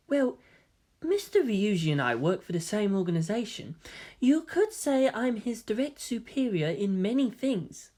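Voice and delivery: lightly voiced, soft polite boy; gentle young man. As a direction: speak calmly and politely. Voices soft-spoken Kaoru.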